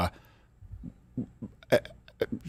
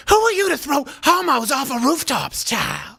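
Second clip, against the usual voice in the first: Whoopi impression; 10 semitones higher, slower than usual. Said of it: "let's see her go after".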